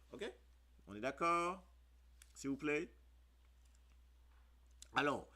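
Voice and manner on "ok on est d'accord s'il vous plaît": higher, louder call for agreement; insistent check. Real, not acted. Teacher to learners: insistent, louder check for agreement. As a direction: press the class for a yes.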